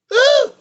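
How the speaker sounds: high whooping woo. Real, not acted. Storyteller whooping at the crooked guards, loud woo. Whoop after dirty dogs.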